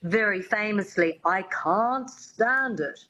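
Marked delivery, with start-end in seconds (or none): 1.2-3.0 slow, careful Mid-Atlantic "I can't stand it" with a broad open "can't"; the coach demonstrating the refined "can't stand it"; coach's proper Mid-Atlantic. Retold or imitated. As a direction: model the line like an elocution teacher, slowly. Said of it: "her dialect coach says".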